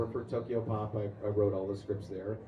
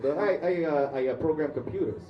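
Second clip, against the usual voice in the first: hesitant, stammered imitated New York accent; loud, halting answer in New Yorker accent; strong New York accent; 4 semitones higher, 5 dB louder than usual. Subway stranger saying his job, imitated accented stammer. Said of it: "with that strong of an accent".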